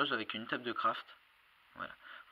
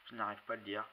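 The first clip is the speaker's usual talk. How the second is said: ordinary delivery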